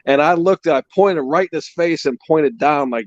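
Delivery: ordinary talk, nothing marked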